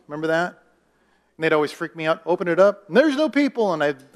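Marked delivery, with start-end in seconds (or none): 2.2-3.7 louder, rising to a high "no people"; high, loud punchline on "no people"; wrong rhyme version. Retold or imitated. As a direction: play up the shock ending of a children's rhyme. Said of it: "that's not the way it goes".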